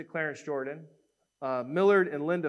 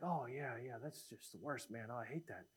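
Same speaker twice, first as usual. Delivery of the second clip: quiet agreement; 5 semitones lower, 16 dB quieter than usual. Retold or imitated. The shooter's agreement.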